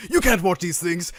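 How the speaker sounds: mock-angry dad telling him off; mild mock scream of a scolding parent; imitated screaming father. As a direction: act out the outraged father. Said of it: "screams at him and goes".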